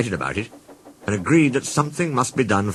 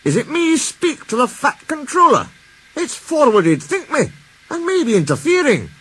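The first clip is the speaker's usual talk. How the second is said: much higher, unsure Scottish engine; a shy engine doubting himself; Douglas hesitant; 4 dB louder than usual. A narrator's reading. Douglas voiced in story.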